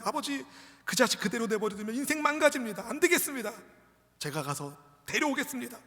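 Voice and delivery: high, loud, impassioned plea; acted desperate resolve, pushing to a shout; urgent son's vow. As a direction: beg father to let you go. Acts son urgently vowing to rescue brother.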